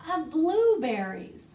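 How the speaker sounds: animated library storytime voice; reading to children. Acted. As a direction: tell it like a picture book for toddlers. Reads flannel story to children.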